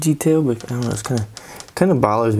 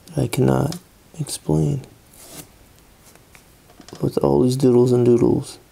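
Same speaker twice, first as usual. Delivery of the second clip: doodle poetry. Pretend. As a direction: recite a made-up poem lightly. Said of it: "It's doodle poetry".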